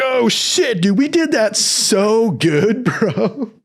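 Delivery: high, slow amazed bro voice; stoked recruit. Retold or imitated. Recruit amazed at marching in step, high and slow. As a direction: marvel high and drawn out. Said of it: "you're like".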